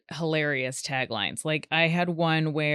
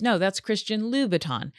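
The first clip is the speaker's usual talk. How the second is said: ordinary talk, nothing marked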